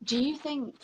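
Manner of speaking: ordinary talk, nothing marked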